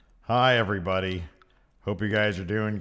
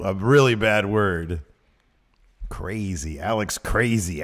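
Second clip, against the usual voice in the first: ordinary delivery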